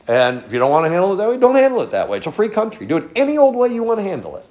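high, loud, fast shrug-off; dismissive exasperation. Real, not acted. Preacher waving off unwilling listeners, exasperated and dismissive. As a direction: throw it out high and fast, as if washing your hands of them.

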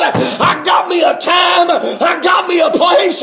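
high preaching cry on "I got me a time"; shouted refrain. Real, not acted. Preacher mocking his old profession, shouting it out. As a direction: ring out "got" high and strong.

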